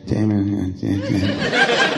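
mock mumbled old-man trailing off, slow; a lightly voiced doddering mutter. Teasing the guest for mumbling his story, mock old-man mutter. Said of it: "You're doing that old man muttering thing".

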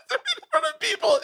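high giggling correction; laughing it off through the words. Real, not acted. Correcting himself about a person, laughing.

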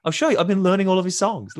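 high, loud eager boast. Retold or imitated. Telling the producers he can sing, high eager retold boast. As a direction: bounce it out like a keen kid proving himself. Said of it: "I'm like".

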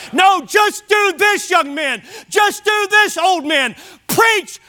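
high, emphatic pulpit exhortation; forceful preaching. Real, not acted. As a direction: drive each key word home, slowly at the climax. Exhorts the congregation.

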